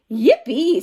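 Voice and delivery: loud yippee; excited. Acted. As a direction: cheer for the outing. Froggy excited about golf.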